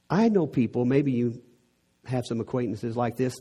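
ordinary talk, nothing marked

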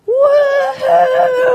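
high, loud triumphant whoop; cheering kid. Retold or imitated. Crashed kid cheering back up the hill, loud whoop. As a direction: whoop like a kid who just survived a crash. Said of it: "he'd do something like this".

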